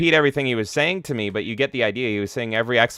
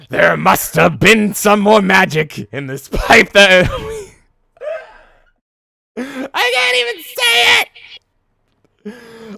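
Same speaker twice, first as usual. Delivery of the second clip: laughing; 11 semitones higher, 8 dB louder, much slower than usual. Real, not acted. Cracking up at a meme.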